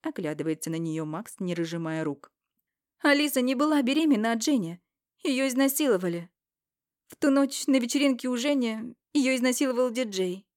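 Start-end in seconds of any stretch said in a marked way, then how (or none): 3.0-10.5 higher, louder, fast female voice; Irina's urgent revelation. Acted. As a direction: blurt out the truth. Ирина раскрывает правду. (взволнованная Ирина, выше и громче)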